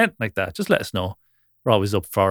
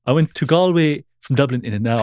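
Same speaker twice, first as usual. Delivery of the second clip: put-on Dublin accent; 4 semitones higher, 4 dB louder than usual. Boasting Dublin driver caricature.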